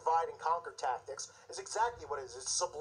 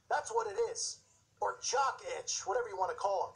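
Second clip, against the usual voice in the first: ordinary talk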